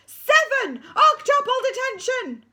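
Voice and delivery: high, loud drawn-out bellow; deputy head screaming the punishment; furious shout. Acted. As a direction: roar detention at the school.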